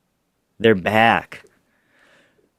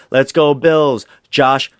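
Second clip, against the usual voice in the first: louder, higher imitation of a player's hype; retold rallying cry, pumped up; excited cheer; 5 semitones higher, 6 dB louder, slower than usual. Imitating the new signing cheering for his team, excited.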